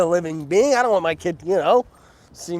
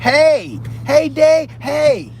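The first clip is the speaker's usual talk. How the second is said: fan calling out; 9 semitones higher, much slower than usual. Retold or imitated. Fans shouting at him.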